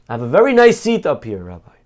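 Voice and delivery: a touch of joyful boasting. Acted. Dead Yosele boasting of heaven.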